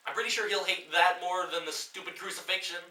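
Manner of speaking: ordinary delivery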